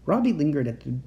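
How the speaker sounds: ordinary delivery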